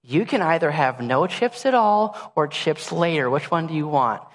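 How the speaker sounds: calm mom voice. Retold or imitated. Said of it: "Meg gets down and goes".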